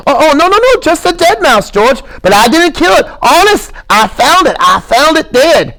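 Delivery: put-on high stammering childlike plea; Lenny, earnest. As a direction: insist like a scared child. Lenny pleading.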